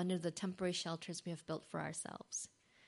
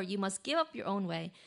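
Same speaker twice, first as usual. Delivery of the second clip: usual speaking voice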